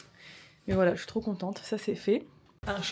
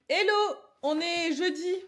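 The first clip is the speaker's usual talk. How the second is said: bright vlog greeting; 8 semitones higher, 3 dB louder than usual. Real, not acted. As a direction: greet the camera cheerfully.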